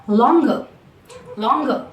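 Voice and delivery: "longer" ending in a schwa, no R. Shown as a demonstration. Teacher showing the schwa in comparatives. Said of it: "the schwa sound is what we hear".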